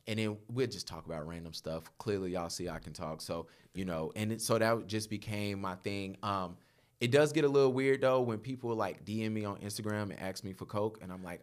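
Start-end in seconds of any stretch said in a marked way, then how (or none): none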